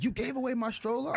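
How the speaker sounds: imitating an incredulous wife, voice jumping high; high-pitched retold disbelief; shocked disbelief. Wife confronts husband over the stroller, mock-shocked.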